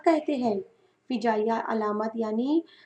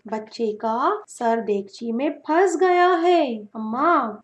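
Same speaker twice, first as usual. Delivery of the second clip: ordinary delivery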